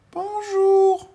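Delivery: very high, loud little greeting; tiny little voice. Acted. Tiny man calls up to the postman, very high voice. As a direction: pipe up from the ground. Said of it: "une petite voix".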